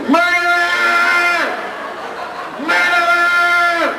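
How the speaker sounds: high, loud, long-drawn imitation scream; mimicked child's scream. Daughter accusing mom in the supermarket, mimicked screaming. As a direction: screech it like a kid making a public scene. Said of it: "She starts screaming in the Kroger supermarket".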